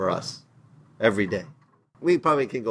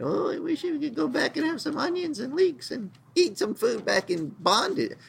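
much higher, whining mock complaint; whiny grumbling; 12 semitones higher than usual. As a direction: whine like a spoiled crowd longing for old food. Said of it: "complaining and grumbling and murmuring".

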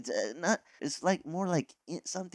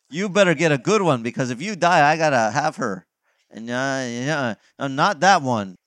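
loud, pushy warning; bossy brother; 9 dB louder than usual. Acted. Younger brother warns older brother picking a bride, loud and bossy. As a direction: call it out like a nosy younger brother.

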